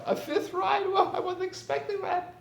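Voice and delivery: put-on very high, amazed childish voice with a laugh; delighted child. Child to father granted an extra ride, high amazed delight. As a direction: squeal it like an unexpected gift.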